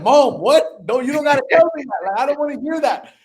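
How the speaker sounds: loud, high, scandalised outburst; appalled protest. Retold or imitated. Son cutting off his mom's remark about getting laid, appalled.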